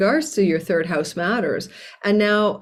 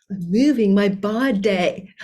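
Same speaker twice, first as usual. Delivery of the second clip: slow, drawn-out taunt; mocking; 2 semitones higher, slower than usual. Astrologer defying critics who say she moves too much, mocking. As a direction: exaggerate it teasingly between laughs.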